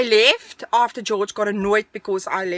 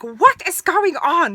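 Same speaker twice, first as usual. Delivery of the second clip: slow, loud, high-pitched outburst; exasperated demand; 7 semitones higher, 5 dB louder, slower than usual. Retold or imitated. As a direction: imitate an alarmed dad demanding answers. Father voiced, roused from shower.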